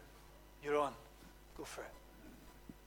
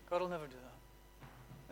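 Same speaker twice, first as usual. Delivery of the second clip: much softer, almost breathed; hushed repeat; 5 semitones lower, 16 dB quieter than usual. Shown as a demonstration. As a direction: let it fall to a hush, gently. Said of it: "repeated softer".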